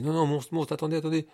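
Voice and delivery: little dog begging in alarm; frightened pleading. Acted. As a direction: plead fast to stop the monsters. Little dog begs the monsters.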